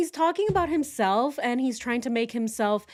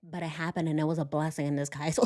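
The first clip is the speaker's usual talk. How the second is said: flat mimicry; 4 semitones lower, 4 dB quieter, faster than usual. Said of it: "look at the way he talks".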